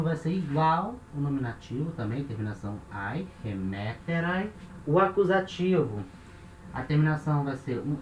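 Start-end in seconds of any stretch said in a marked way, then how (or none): 3.4-4.5 slow "remeterai", weight on ME; accent kept in place. Shown as a demonstration. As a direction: stress "remeterai" on ME, rising at the end. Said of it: "O acento permanece no mesmo lugar".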